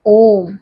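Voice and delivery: ओम with the vowel held very long, three beats; prolonged Om. Shown as a demonstration. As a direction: draw ओम out slowly like a chant. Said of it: "ये त्रिमात्रिक होते हैं". (ओम को तीन मात्रा तक खींचकर बोलें)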